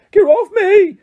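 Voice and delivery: high, loud squeal of a struggling gnome. A narrator's reading. Gnome shrieking as Ron swings it round. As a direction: shriek like a creature being spun.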